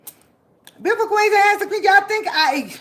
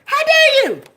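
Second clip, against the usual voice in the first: higher louder indignant How dare; loud rebuke; 10 semitones higher, 7 dB louder than usual. Real, not acted. Scolding her enemies, a loud high How dare you. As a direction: bark the rebuke in outrage.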